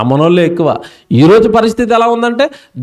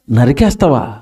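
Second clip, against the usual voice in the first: incredulous rebuke; 9 semitones lower than usual. Acted. Preacher scolds a killer.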